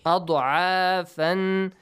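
أَضْعَافًا recited with long a and held final n; ikhfa. Shown as a demonstration. Teacher reciting for ikhfa, أَضْعَافًا with long nasal ending. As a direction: draw out أَضْعَافًا, humming its ending. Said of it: "Ikhfa again".

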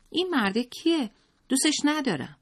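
put-on high petulant child voice; high, sulky boy asking who the man is; child's voice. A narrator's reading. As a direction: play a jealous little boy. Child Zalmai voiced resentfully.

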